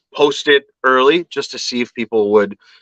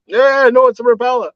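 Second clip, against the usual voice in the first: much higher, louder imitation of a stubborn local fisherman; mock local angler; 3 dB louder than usual. Narrator imitating a local fisherman who insists every lure is a Rapala, high and stubborn.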